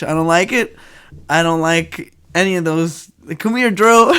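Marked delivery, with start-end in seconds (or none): 3.4-4.1 laughing, loud calling coo; mock dog-owner call. Mocking a dog named Drew, laughing high call.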